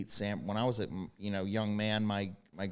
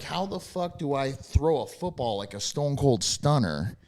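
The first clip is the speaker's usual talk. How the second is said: excited; 6 semitones higher, 8 dB louder than usual. Retold or imitated. Excited self-quote.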